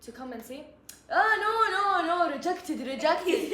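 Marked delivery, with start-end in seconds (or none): 1.1-3.4 louder, higher mock yell of the teacher; teacher loudly rejecting her idea, higher and louder; mock scream. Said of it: "She was screaming".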